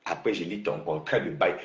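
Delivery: low, hushed mock quote; mockingly small, low voice; mimicked threat. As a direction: drop low and soft to mimic the rival.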